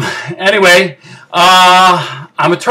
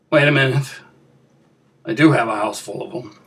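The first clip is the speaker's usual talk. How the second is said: low, soft, quick aside; abruptly quiet and matter-of-fact; sudden sober realization; 9 semitones lower, 9 dB quieter, much faster than usual. Acted. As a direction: drop the crying and murmur it fast to yourself. Sudden realization amid crying.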